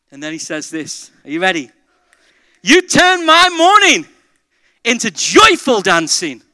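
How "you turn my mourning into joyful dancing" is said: high, loud and drawn-out; slow, exultant pulpit cry; jubilant declaration. Acted. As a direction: proclaim the psalm with rising joy. Preacher declaims psalm of joy.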